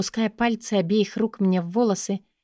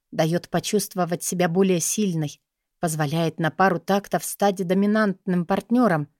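ordinary delivery

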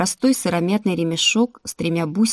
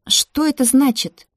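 sharply demanding, pitched higher; higher-pitched, sharp challenge; sharp question; 6 semitones higher, 4 dB louder than usual. A narrator's reading. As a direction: ask it abruptly and insistently. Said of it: "резко спросила Мария Антония".

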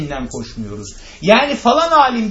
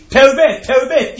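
loud, higher cry of "tevbe et"; retold shout; 5 semitones higher, 6 dB louder than usual. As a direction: shout it like an alarmed bystander. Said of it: "diye bağırdı".

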